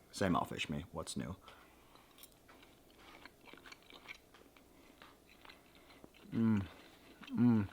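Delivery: talking with a mouth full of food. Real, not acted. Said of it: "talking with my mouth full".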